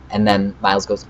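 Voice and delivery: normal talking voice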